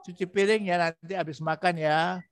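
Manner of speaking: lightly voiced tender mother; gentle request. Shown as a demonstration. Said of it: "Jangan begitu, tapi".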